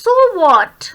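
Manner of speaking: high, loud "so what" with force on "what"; stress on what. Shown as a demonstration. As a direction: push the pitch up and hit "what". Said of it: "what पे हम stress देते हैं". ("what" पर ज़ोर, ऊँची आवाज़)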